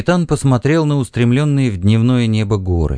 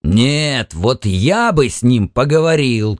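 acted fierce outburst without real shouting; narrator's contained angry exclamation; angry cry; 2 semitones higher, slower than usual. A narrator's reading. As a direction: retort heatedly, as a furious man would. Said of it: "крикнул Паркхилл, яростно сверкнув глазами".

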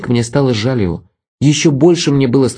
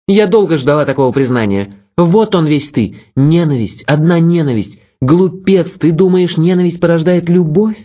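higher cool woman's voice, distant; cold, superior young woman; Vera, cold; 6 semitones higher than usual. A narrator's reading. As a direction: voice her aloof and cutting. Vera scorns Pavel.

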